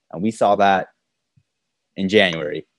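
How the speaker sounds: normal talking voice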